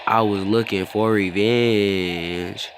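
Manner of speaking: slow, mock-dramatic vow with a long held ending; drawn-out vow. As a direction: stretch the last word out long.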